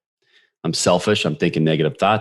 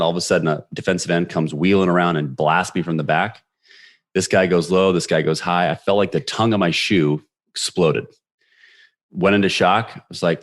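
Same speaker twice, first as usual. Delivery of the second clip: ordinary talk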